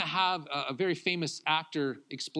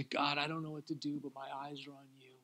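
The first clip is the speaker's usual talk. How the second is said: soft hushed prayer, fading at the end; a whispered-down surrender to God; quiet prayer; 3 semitones lower, 9 dB quieter than usual. Retold or imitated. Couple praying together on their own, soft and hushed. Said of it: "we would stand and we would say".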